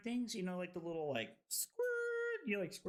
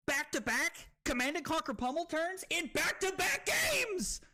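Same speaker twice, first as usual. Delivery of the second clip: much higher, jubilant yell; excited shout; 11 semitones higher, 3 dB louder than usual. Real, not acted. Streamer bragging about his turns, excited shout. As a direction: shout the brag to the whole room. Celebrating back-to-back wins.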